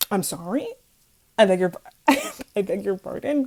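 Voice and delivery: loud, laughing mock disbelief; incredulous reaction. Student answering a dating rumour, incredulous and laughing. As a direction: act taken aback, breaking into a laugh.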